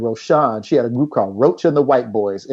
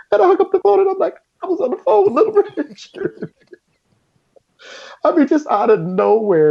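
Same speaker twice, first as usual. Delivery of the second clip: thrilled disbelief. Real, not acted. Thrilled after call with star.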